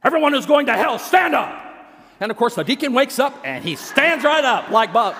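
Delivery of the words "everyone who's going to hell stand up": sudden higher, louder call; preacher raises his voice on the trick question. Retold or imitated. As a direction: jolt the sleeping deacon awake.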